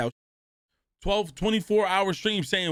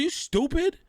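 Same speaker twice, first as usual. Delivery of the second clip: retold scolding; 10 semitones higher than usual. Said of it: "I said".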